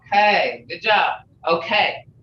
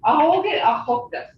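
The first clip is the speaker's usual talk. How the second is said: command tone; 8 semitones higher than usual. Shown as a demonstration. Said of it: "That's a command".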